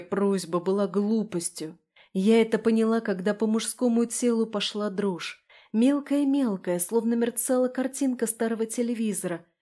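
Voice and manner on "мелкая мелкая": ordinary delivery, nothing marked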